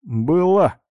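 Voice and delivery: loud sneering from the corridor; mocking. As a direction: sneer it from down the hall. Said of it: "насмешливо добавил третий голос из коридора".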